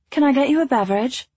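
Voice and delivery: bright, quick attendant voice; flight attendant voice. A narrator's reading.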